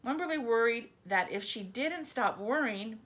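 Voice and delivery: ordinary delivery, nothing marked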